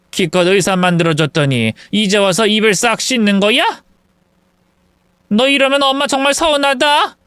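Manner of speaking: a lightly voiced aggrieved mother-in-law; reproachful mother-in-law. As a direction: scold your son like a wounded mother. Mother-in-law scolds son.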